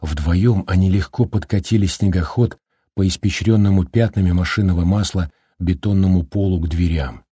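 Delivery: ordinary delivery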